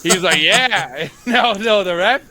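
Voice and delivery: can't stop laughing at the story. Real, not acted. Telling a friend the ref story, cracking up.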